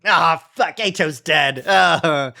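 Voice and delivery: loud upset outburst; real dismay, blurted out loud. Real, not acted. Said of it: "I got sad".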